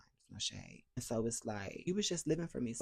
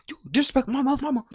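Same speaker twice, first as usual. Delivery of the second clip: angry accusation; 11 semitones higher, 4 dB louder, faster than usual. Retold or imitated. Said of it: "He goes".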